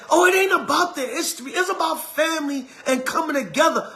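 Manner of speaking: high mock sing-song excuse; mocking relative. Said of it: "and then".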